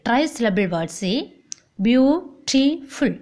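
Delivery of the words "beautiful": slow "beautiful" in three separate beats; split into syllables. Shown as a demonstration. Teacher counting three syllables for class, slow syllable by syllable. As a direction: draw out "beautiful" with gaps between syllables. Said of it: "Three syllable".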